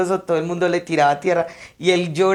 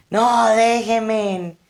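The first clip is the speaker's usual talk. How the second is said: high, drawn-out refusal, imitating the son; retold angry shout; 5 semitones higher, much slower than usual. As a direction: stretch the no out in a high protesting yell. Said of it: "les gritaba".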